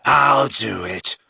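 slow snarl; a touch of werewolf snarl. A narrator's reading. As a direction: growl it out eagerly. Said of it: "snarled Fenrir".

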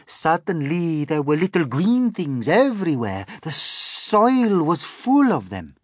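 high, loud, emphatic spider; Miss Spider's voice. A narrator's reading. Miss Spider tells of little green things, amazed. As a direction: recount the wonder loudly.

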